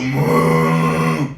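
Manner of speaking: low, loud angry moo; a deep, loud, grumbling cow moo. Retold or imitated. Comic showing how an angry cow moos, low and loud. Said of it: "when they were angry".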